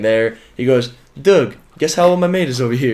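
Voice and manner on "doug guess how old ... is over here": bad British accent, slightly higher; attempted British accent. American retells a fan's question in shaky British accent. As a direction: imitate a British kid, accent badly faked. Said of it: "Is that your British accent?".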